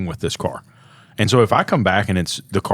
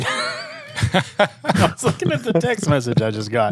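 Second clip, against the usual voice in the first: laughing through the words; slow, giggling reply; 9 semitones higher, slower than usual. Real, not acted. Laughing while answering friend.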